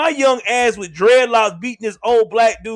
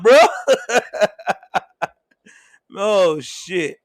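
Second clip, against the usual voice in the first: ordinary delivery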